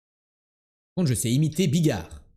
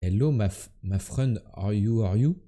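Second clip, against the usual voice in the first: put-on half-hearted Indian-accented English; softly tried-out Indian accent; 4 semitones lower, 4 dB quieter than usual. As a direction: attempt an Indian accent, haltingly. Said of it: "Go accent indien".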